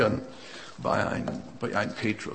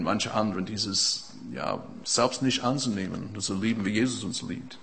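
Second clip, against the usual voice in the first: ordinary talk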